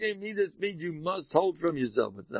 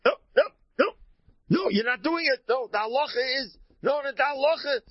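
loud, high, indignant refusals; the too-frum husband loudly forbidding his family; overzealous frum husband; 10 semitones higher, 4 dB louder, faster than usual. Retold or imitated. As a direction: bark it like a stickler forbidding everything. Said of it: "He's so".

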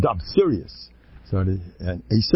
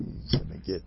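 ordinary talk, nothing marked

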